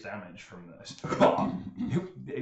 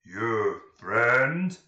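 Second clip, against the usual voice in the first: simple ogre voice; 9 dB louder than usual. Acted. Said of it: "comes over and says".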